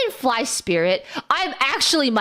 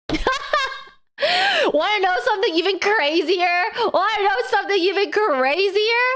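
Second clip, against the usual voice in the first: laughing tease; 6 semitones higher than usual. Real, not acted. Laugh heard.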